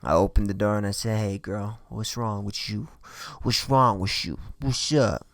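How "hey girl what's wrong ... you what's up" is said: low-key, soft smooth-talking come-on; turning on the charm. Retold or imitated. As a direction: sweet-talk them quietly, as if flirting. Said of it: "turn on the charm".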